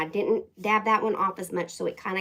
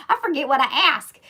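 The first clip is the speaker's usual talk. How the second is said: said through a real laugh, higher and louder; 6 semitones higher, 9 dB louder than usual. Real, not acted. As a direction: laugh at yourself while saying it. Laughs at own forgetfulness.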